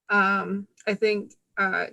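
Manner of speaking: ordinary delivery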